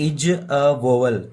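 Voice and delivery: slow, deliberate quiz statement; very slow claim. Shown as a demonstration. Teacher repeats the claim very slowly. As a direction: stretch each word out. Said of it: "अगर मैं आपको ऐसे कहूँ".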